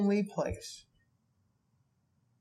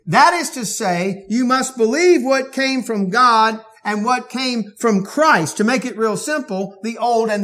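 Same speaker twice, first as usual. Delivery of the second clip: raised, loud, emphatic pulpit voice; forceful preaching; 7 semitones higher, 7 dB louder than usual. Acted. Preacher thundering to his congregation about worshiping Jesus, loud and emphatic. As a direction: drive each claim home to the hearers.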